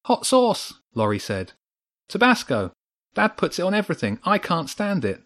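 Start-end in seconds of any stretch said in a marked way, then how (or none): 0.1-0.8 put-on very high-pitched child voice; excited child's blurt. A narrator's reading. Laurie offering an idea, excited high child voice. 2.1-5.2 put-on high, fast child voice; excited young boy. A narrator's reading. Laurie explaining his idea, excited high child voice.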